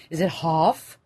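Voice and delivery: lightly voiced dad asking, "half" as "hoff"; mock dad with an East Coast vowel; East Coast accent. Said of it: "he's like".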